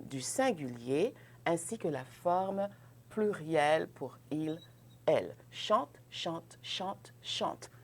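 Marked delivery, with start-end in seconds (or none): 5.5-7.7 "chante" repeated, each ending silent, one identical sound; same sound each time. Shown as a demonstration. Teacher shows all forms sound the same, "chante" with a silent ending. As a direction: say every "chante" exactly alike, final e silent. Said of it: "C'est la même prononciation".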